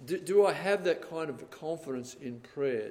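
ordinary delivery

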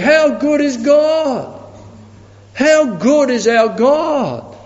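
high, slow, marvelling exclamation; preacher marvelling at God's goodness; slow fervent praise. Acted. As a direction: savour each word in wonder.

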